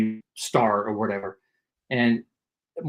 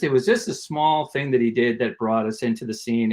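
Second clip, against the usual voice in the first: ordinary talk, nothing marked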